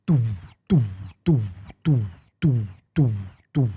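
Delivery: thump out doo like a drum; drum fish call. Shown as a demonstration. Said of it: "it goes".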